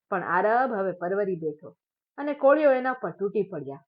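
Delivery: ordinary delivery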